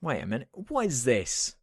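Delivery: ordinary talk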